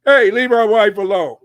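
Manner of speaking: imitated loud confrontation at the job; dumb husband confronting. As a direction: call out like a husband butting in. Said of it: "if you're a dumb husband, you'll go up to the job".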